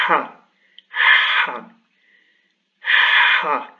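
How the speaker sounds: "Ha" breathed hard from the throat; "Ha" with a strong throaty breath; Arabic breathy ha. Shown as a demonstration. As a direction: push "Ha" out like warming your hands. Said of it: "Il se prononce".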